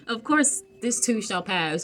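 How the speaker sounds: high, sing-song mock reassurance; sarcastic high-pitched "of course", then slow; mock platitude. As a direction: parrot the empty comfort with a sneer. Mocking a hollow platitude.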